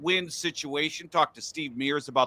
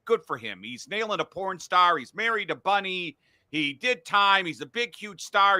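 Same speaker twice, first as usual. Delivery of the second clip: ordinary talk, nothing marked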